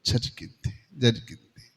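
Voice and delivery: low hushed whisper; whispered hint that something happened. Acted. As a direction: drop to a secret whisper. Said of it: "దాక్కున్నాడు అంటే ఏదో".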